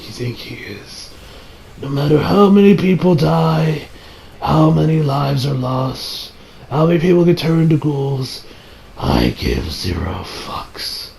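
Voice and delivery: slow, low, sinking villain monologue; villain's dying speech. Acted. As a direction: let it fade slowly to a low, cold finish.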